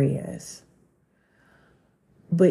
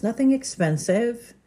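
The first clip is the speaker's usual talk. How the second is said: ordinary delivery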